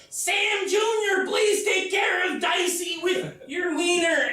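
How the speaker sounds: high, slow, drawn-out call; a dying soldier hollering through a door; yelling out the door. Retold or imitated. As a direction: call out from the outhouse to a friend. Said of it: "he's yelling out the door".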